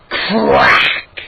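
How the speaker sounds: drawn-out imitated cracking noise; loud sharp crack. A narrator's reading. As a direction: make the word crack like splitting wood. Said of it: "a loud, sharp".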